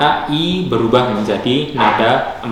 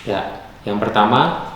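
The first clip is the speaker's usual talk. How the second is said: normal talking voice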